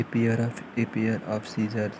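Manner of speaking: very slow, spelled-out example phrase; slow dictation. Shown as a demonstration. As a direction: dictate each word for the students. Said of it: "dictating example sentence slowly". (धीमा उदाहरण)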